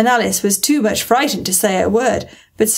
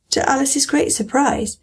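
plain reading voice

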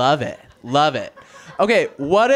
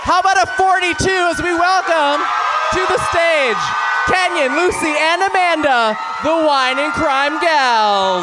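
announcer shout; 9 semitones higher than usual. Real, not acted. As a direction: hype them up like a ring announcer. Host introduces the podcast on stage.

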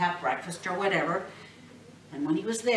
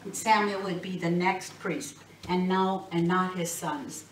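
plain reading voice

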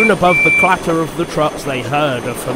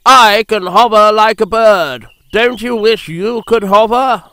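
higher, louder bragging; boastful helicopter; 6 semitones higher, 5 dB louder than usual. A narrator's reading. Harold boasts to Percy about hovering, superior. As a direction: show off proudly with a lifted voice.

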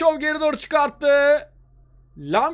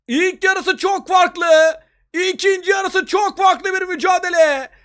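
high, raised voice, still thrilled; excited football commentator; 4 semitones higher than usual. Real, not acted. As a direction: relive the goal with excitement. Replay of the winning goal. (heyecanlı maç spikeri)

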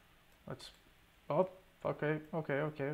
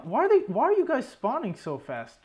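frustrated shout; 9 semitones higher, 7 dB louder than usual. Real, not acted. Frustrated gamer yelling at mobs.

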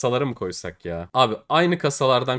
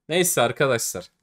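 ordinary talk, nothing marked

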